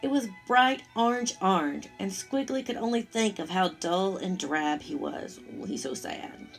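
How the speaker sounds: ordinary delivery, nothing marked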